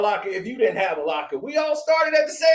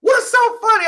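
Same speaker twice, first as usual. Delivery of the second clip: high shouted demand; mild mock yell of an annoyed girlfriend; retold angry shout; 10 semitones higher, 3 dB louder than usual. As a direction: snap it back at him, mock angry. Girlfriend angrily asking on phone.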